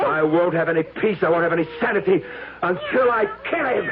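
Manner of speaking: high, raving threat. Acted. Phil raving to Sylvia that he must kill the cat. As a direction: rant like a madman.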